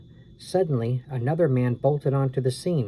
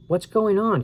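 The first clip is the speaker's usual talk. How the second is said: high alarmed call; alarmed shout; 8 semitones higher, 3 dB louder than usual. A narrator's reading. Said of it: "Karen shouted".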